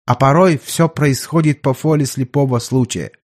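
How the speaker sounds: put-on thoughtful doctor with a German accent; German doctor, explaining. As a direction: explain it patiently as a German physician.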